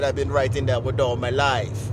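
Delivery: imitated low singer's voice. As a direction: drop deep to quote the singer. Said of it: "And [name] said".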